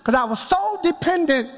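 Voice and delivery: ordinary talk, nothing marked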